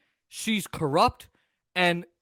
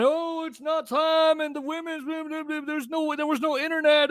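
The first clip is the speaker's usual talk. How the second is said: mocking voice; 9 semitones higher, 3 dB quieter than usual. Mocking opponent's excuses.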